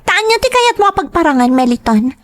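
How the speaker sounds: high, pointed challenge; angry challenge. Acted. Mistress confronts Meliton, angry.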